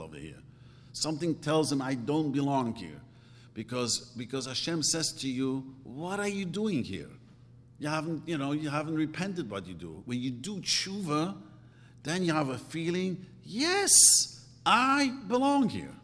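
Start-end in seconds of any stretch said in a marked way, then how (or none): none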